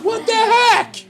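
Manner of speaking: high, loud "what the heck"; baffled outburst. Pretend. Child opening an empty Christmas box, high and loud in disbelief. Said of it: "wouldn't you look at, like".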